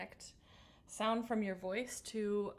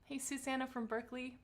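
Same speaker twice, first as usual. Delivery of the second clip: calm grounding exercise. Acted. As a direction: guide the breath gently. Leads breathing warm-up.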